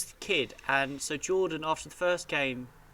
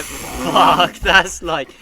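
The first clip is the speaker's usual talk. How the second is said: loud, stunned exclamation; amazed shout; 9 dB louder, much slower than usual. Retold or imitated. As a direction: blurt it out, blown away. Amazed reaction to Jordan's story.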